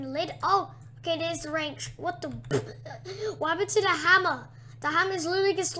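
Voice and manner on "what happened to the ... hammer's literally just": loud, fast surprised exclaim; surprised outburst. Real, not acted. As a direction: blurt it out in shock. Surprise at hammer.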